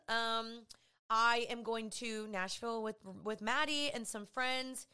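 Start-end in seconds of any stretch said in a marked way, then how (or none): none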